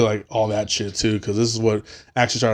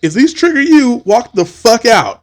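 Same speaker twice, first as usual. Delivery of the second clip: promo announcer; 9 semitones higher, 5 dB louder than usual. Retold or imitated. Bill and Ted promo warning the audience, mock announcer. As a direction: warn the crowd bluntly. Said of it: "video promo before the show".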